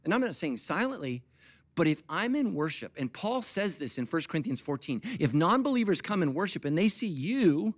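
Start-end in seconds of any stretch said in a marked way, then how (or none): none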